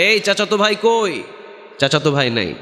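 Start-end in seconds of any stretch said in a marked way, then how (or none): 0.0-1.2 higher-pitched call for a relative. Acted. Returning man calls for his cousin, calling out. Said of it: "চাচাতো ভাইকে ডাকে".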